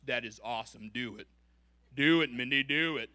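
low tempting devil voice, building to a loud urge; enemy's voice. Acted. Devil tempting Mindy to marry for wealth, low coaxing voice. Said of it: "it may sound something like this".

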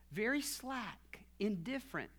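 usual speaking voice

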